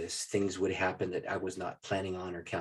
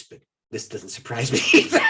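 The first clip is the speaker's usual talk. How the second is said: louder, breaking into laughter; laughing; 15 dB louder than usual. Real, not acted. Laughing as he answers his dad. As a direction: laugh through the punchline.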